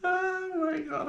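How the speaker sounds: high, slow amused gasp; amused surprise. Real, not acted. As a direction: react like you can't believe the photo. Reacting to old senior picture.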